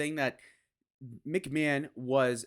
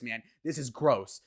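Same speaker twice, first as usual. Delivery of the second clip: ordinary talk, nothing marked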